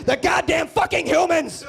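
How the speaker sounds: high, loud furious shout; mock nature narrator snapping; angry narrator. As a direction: narrator losing it at humanity. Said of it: "he'll just get me".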